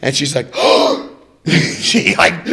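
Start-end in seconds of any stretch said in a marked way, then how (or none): 0.6-1.1 loud, sharp intake of breath; acted gasp. She spots the captain's picture, acted gasp. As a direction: gasp in mock shock.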